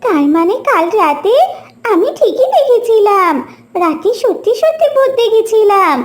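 ordinary delivery

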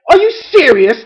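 high, loud exasperated disbelief; incredulous mother. A narrator's reading. Mother to ducklings who lost another, incredulous. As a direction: demand it like a mom at wit's end.

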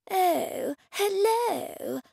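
high, drawn-out hello; gallery owner's greeting. A narrator's reading. Man outside greets the artist at her door, in a high lilting hello.

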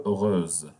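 "heureuse" ending in a z sound; slow "heureuse", the s voiced as z. Shown as a demonstration. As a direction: buzz the s between the vowels in "heureuse". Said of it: "so we pronounce it like that".

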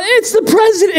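high, excited call-out; excited yell. Retold or imitated. Driver spotting the president, calling out high and excited. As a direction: yell it out to the car. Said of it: "And I yell out".

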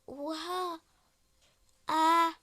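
echoing shout in a big hall; cave echo. Real, not acted. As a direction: call out to hear the echo. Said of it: "Sesim yankılanıyor". (yankılı bir sesle)

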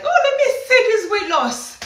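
high, loud, eager squeal; can't wait to see the result; excited, pitch shooting up; all excited. Retold or imitated. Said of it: "all excited".

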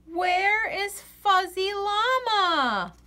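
ordinary delivery

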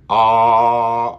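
loud, held "Ah" as back AW; sustained back "Ah", tongue away from teeth; back vowel demo. Shown as a demonstration. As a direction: stretch "Ah" far back like "aw". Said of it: "hay un espacio aquí".

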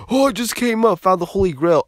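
high, loud dumb-excited voice; hammed-up gleeful idiot; mocking excited friend. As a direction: crow it like a fool who struck gold.

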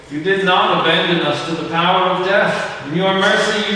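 normal talking voice

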